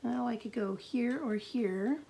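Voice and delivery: usual speaking voice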